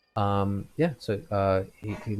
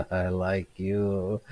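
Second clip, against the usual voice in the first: put-on very slow, drawn-out drunk fondness; much slower than usual. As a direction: savour each word, tipsy. Drunk man pats stranger.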